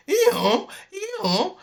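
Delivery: very high, loud hee-haw; donkey bray. A narrator's reading. Dilly ambushes the robbers, loud high donkey braying.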